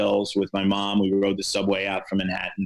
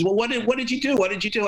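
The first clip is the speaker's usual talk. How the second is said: much higher, mock-alarmed agent voice; hammed-up panicked demand, pitched way up; 11 semitones higher, faster than usual. Agent Dick demands what the reporter did, high mock alarm. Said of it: "Dick says to me".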